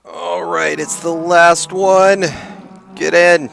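high, loud urging; eager urging. Real, not acted. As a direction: push the last one in. Urging last cow, high loud.

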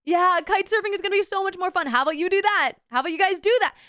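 high, lifted, insistent sales pitch; imitated instructor's eager urging; persuading surf instructor. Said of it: "he's trying to convince us".

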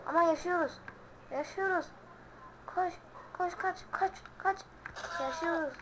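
high, breathless cheering; thrilled to still be alive; excited. Real, not acted. Hayatta kalma sevinci oyunda. (heyecanlı, yüksek perdeden sevinç)

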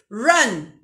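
"Run" with the R curled back to the palate; teacher demonstrating a retroflex R in "Run". Shown as a demonstration. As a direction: roll the R of "Run" up on the roof of the mouth. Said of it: "Olha esse R lá no céu da boca".

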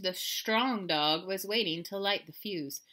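ordinary delivery, nothing marked

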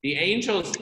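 normal talking voice

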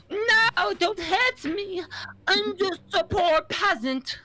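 mocking the victim of the truth; pitiful peasant. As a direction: beg like a poor peasant. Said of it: "he was just like".

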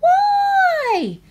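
put-on high whiny little girl; cartoon princess. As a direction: squeak it like a small princess. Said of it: "said the little princess".

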